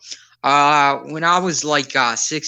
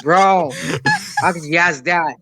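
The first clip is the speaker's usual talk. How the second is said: higher, emphatic protest; indignant; 5 semitones higher than usual. Real, not acted. Italian American answering the pineapple pizza question, indignant. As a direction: protest like it's a personal affront.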